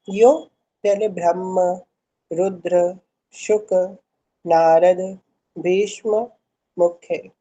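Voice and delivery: each word slowly and separately; broken into pieces. Shown as a demonstration. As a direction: read word by word, pausing between. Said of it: "देखो".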